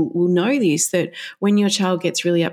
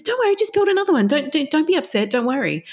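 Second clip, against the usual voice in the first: fast, much higher dismissive soothing; soothing carer; 8 semitones higher than usual. Retold or imitated. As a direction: brush off the upset kindly. Carer soothes upset child.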